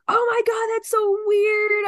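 the cousin flipping out; shocked cousin squeals in delight; stunned gasp. Retold or imitated. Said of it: "She was like".

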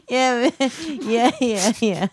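normal talking voice

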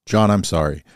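ordinary talk

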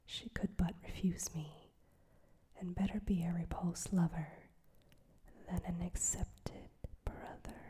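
plain reading voice

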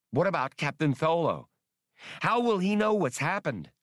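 a jittery high-pitched fret; worried. Acted. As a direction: panic rising with each question. Spray suddenly worried about captain.

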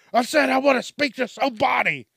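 loud, high angry demand; angry shout. Pretend. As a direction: yell it at the phone. Caller yelling at the machine.

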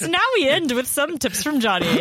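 high, lively radio outro; segment announcer. Pretend.

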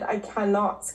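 ordinary delivery

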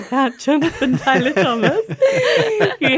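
laughing through the words. Real, not acted. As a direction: giggle while telling it. Laughs at own teen obsession.

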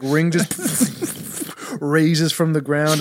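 ordinary talk

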